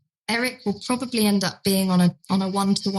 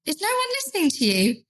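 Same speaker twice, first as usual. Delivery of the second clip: higher, gentle coaxing; meeting him gently; 8 semitones higher than usual. Retold or imitated. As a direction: coax him kindly toward the door. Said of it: "try and meet him where he's at".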